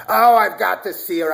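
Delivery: a high, loud gush; mother-in-law. Retold or imitated. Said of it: "my mother-in-law said".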